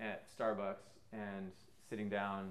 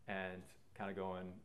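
normal talking voice

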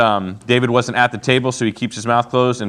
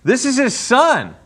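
stunned disbelief; 13 semitones higher, 3 dB louder, much slower than usual. Real, not acted. Reacting to the spear throw.

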